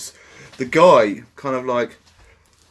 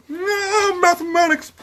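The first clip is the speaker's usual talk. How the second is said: very high mock-protest squeal; mock whine; slower than usual. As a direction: squeak it out in a silly falsetto. Mock protest in banter about maths.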